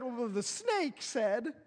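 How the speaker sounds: slow, hammed-up blaming; drawn-out mock excuse; mock Eve excuse. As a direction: Eve pointing at the snake, lightly hammed. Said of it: "And Eve said".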